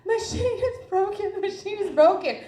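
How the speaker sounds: mock-distraught wail, pitched high; screaming and crying. Patient in pain wailing to the nurse, screaming and crying. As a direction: act out a frantic, high-pitched cry. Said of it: "I was screaming and crying".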